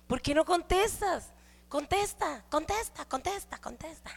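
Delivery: a touch of a hysterical mother's pleading; frantic mom. Retold or imitated. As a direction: nag the phone over and over. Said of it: "se pone bien histérico".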